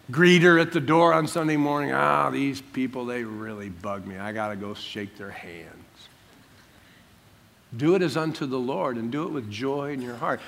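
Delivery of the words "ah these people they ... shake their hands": low, soft weary griping; mock reluctant grumble. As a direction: play a greeter dreading the handshakes, trailing down. Voices a reluctant church greeter.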